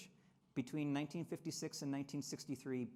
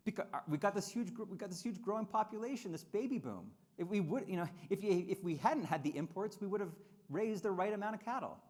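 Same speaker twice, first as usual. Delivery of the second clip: higher, louder mock excuse; mocking advisors; 6 semitones higher, 4 dB louder than usual. Mocking the advisors, a whiny excuse to cattlemen. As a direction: whine it out like a bureaucrat blaming imports. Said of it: "And they say".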